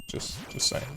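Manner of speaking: whispered teasing aside. Real, not acted. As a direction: whisper it under your breath.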